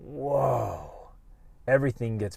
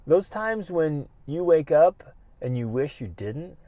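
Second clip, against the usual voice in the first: ordinary talk, nothing marked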